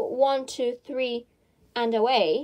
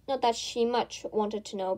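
usual speaking voice

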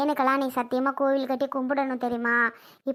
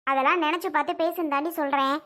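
ordinary talk

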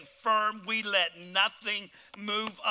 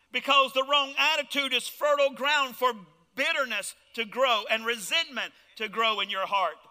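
ordinary talk

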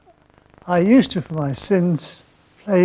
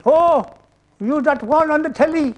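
high, loud Irish brogue; chirpy Irish official, broad brogue; an Irish official recognizing a celebrity, broad brogue; Irish accent; 9 semitones higher, 3 dB louder than usual. Retold or imitated. Irish embassy man answers phone.

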